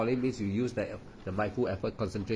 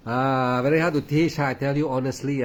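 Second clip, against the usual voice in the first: louder, higher, agitated insistence; sounds agitated; 4 semitones higher, 6 dB louder than usual. Real, not acted. Teacher admitting to students it's hard to teach, agitated. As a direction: press the point with real agitation. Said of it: "it sound like I'm, uh, I'm agitated".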